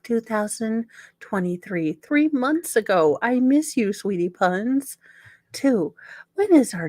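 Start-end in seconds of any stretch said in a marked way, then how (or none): none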